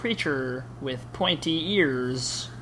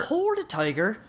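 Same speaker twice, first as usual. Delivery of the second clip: higher Irish leprechaun voice; Irish accent; 7 semitones higher than usual. Acted. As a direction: leprechaun in a light Irish brogue. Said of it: "said the leprechaun".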